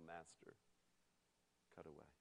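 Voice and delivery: very soft, low surrender; whispering low. A narrator's reading. Bamboo yields to his master, whispering low. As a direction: let it fade to a hush. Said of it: "whispering low".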